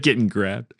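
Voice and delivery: ordinary talk, nothing marked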